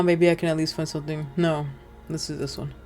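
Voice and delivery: ordinary talk, nothing marked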